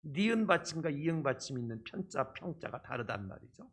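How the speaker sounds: ordinary talk, nothing marked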